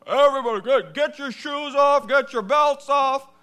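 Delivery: TSA guy shouting. Retold or imitated. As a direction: bellow orders at the airport queue. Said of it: "a T S A guy goes".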